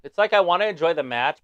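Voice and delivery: loud, keyed-up fan; excited. Real, not acted. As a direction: blurt it out over the match.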